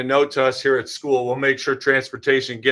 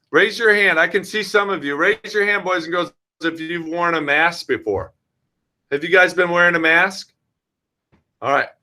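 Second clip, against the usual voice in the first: put-on bright, higher teacher voice for little kids; talking to children; 4 semitones higher than usual. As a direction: coax the kindergartners like a classroom teacher. Principal addresses kindergarten kids directly.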